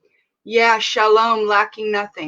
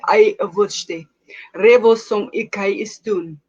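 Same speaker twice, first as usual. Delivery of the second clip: flowing murmured tongues, steady rhythm; speaking in tongues; 3 semitones lower than usual. Real, not acted. Pastor praying in the Spirit before viewers, murmured tongues. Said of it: "We're praying in the Spirit".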